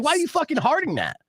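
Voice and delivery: exasperated at the ex-boss hearting the post; annoyed outburst. Retold or imitated. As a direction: throw the question out in disbelief. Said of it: "I'm like".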